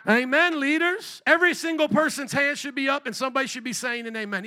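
loud, high preacher call to the room; rally the church to answer; rousing call. Real, not acted. Preacher urging leaders to raise hands, loud rousing call.

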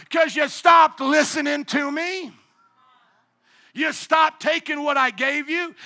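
slow, loud shout; God shouting. Retold or imitated. God rebuking the lost who stopped listening, shouting. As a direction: thunder it down like a rebuke. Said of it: "And God's shouting".

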